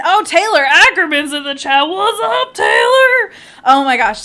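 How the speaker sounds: high, loud burst of delight; real whooping welcome to a friend; excited shout. Real, not acted. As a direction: holler a thrilled greeting. Excited shout-out to chat arrival.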